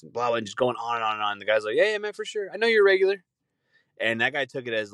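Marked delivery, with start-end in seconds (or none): 1.8-3.2 forced friendliness. Retold or imitated. Manager fake-nice, forced smile.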